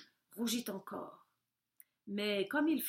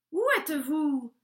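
loud call from a sly wolf; 5 semitones higher, 8 dB louder than usual. A narrator's reading. Wolf calling loudly to the hiding cats. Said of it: "fit le loup".

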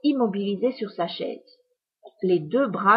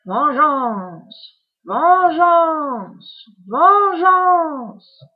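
building from raised to a high, loud shriek; each cry louder and higher than the last; furious witch screaming for revenge; witch cries vengeance; 7 semitones higher, 9 dB louder, much slower than usual. A narrator's reading. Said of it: "Puis elle s'écria".

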